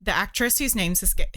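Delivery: normal talking voice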